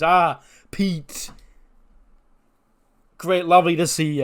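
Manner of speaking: a hammed-up, smug Bryan welcoming Pete; mock Bryan greeting. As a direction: greet him with cheeky fake delight. Mimicking Bryan's gloating welcome.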